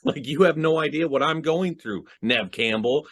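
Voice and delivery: high-pitched wounded protest; hammed-up, worked-up plea; intense pleading. Cotton protesting to Neve Campbell, intense pleading. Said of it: "because he is so intense".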